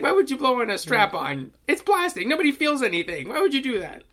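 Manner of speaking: high, loud mock disbelief; cartoon character voice. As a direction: squeal it like an outraged cartoon teen. Voicing cartoon character again.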